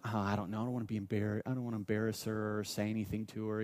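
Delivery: quiet, unsure inner debate; hesitant self-talk. Retold or imitated. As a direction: mumble the worries to yourself. Retelling his own hesitant thoughts.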